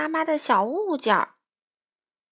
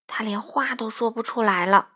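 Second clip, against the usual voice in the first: usual speaking voice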